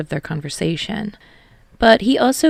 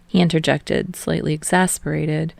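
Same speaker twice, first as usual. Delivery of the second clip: usual speaking voice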